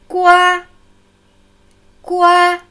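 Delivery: "Gua" held high and steady, loud and even; level first tone. Shown as a demonstration. Teacher demonstrates the high level first tone of "Gua". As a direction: sing out "Gua" on one high flat pitch. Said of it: "Erster gleichmäßig Heulton".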